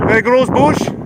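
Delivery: usual speaking voice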